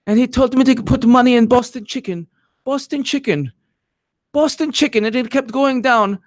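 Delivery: hammed-up Eastern European accent. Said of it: "And she talked like this".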